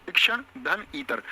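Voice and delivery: शिक्षण धन ईतर said slowly, each part separate; teacher spelling out a word's parts slowly; split into parts. Shown as a demonstration. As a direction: break शिक्षणेतर into शिक्षण धन ईतर, slow and spaced. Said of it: "क्या होता है?".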